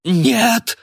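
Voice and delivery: very high, loud cry; desperate scream. A narrator's reading. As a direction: scream in sudden panic. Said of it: "вдруг закричал старик".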